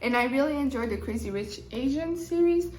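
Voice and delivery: normal talking voice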